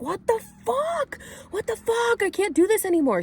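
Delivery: piercingly high, loud re-enacted screaming; imitated freak-out. Re-enacting a woman freaking out at the ceremony, high screamed outburst. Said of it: "freaking out".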